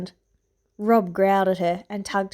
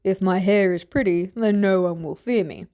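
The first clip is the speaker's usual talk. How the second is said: lightly lowered gruff guard voice; a mild gruff soldier; a hint of a gruff man. A narrator's reading. Gruff guard teasing the little princesses, lightly gruff.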